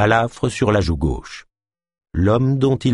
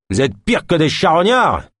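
higher, scornful rant at the gang; angry tirade; 8 semitones higher, 3 dB louder than usual. Acted. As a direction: berate the greedy thugs. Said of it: "vitupéra Dark". (vitupération méprisante, plus aiguë)

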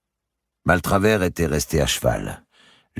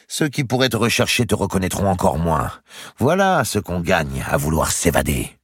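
higher, louder sneering knight; gloating jailer; 6 semitones higher, 3 dB louder than usual. Acted. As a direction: relish the prisoner's humiliation. Narrator voices Maltravers gloating.

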